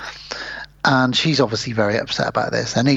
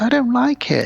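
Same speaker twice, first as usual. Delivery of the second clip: petulant queen; 11 semitones higher than usual. Retold or imitated. Queen refusing, voiced.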